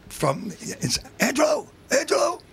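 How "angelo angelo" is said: high call through a crackly phone line; caller over static. Retold or imitated. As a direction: call his name down a bad line.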